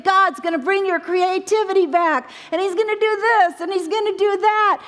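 high, excited praying voice; retold fervent prayer. Sailor praying over the crying lady, high and excited. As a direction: pray over her with bright faith. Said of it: "She's praying over her and saying".

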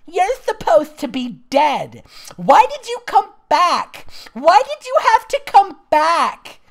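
high, anguished outcry; hammed-up yelping. A narrator's reading. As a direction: wail it at a father back from the dead. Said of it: "she yelped".

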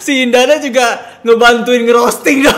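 words through loud laughter; laughing loudly. Real, not acted. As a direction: laugh hard while saying it. Laughing loudly while talking.

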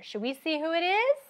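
normal talking voice

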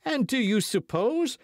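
high-pitched indignant retort, cut off; crying out. A narrator's reading. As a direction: protest sharply, voice way up. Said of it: "cried D'Artagnan".

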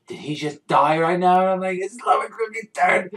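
high, loud, rising panic of a fan; screaming in disbelief. Retold or imitated. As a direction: build from shocked question to near scream. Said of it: "I'm fucking screaming".

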